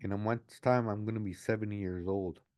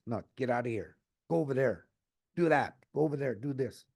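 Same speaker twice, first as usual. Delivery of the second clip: higher, louder mock orders shooing people off; imitating bossy officials; 4 semitones higher, 4 dB louder than usual. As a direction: bark out the brush-off like a rude official. Officials brushing people off rudely.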